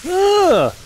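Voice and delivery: high loud cry of alarm; sudden frightened shout; startled yelp. Real, not acted. Stepping on a trap.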